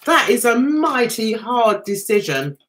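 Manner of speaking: normal talking voice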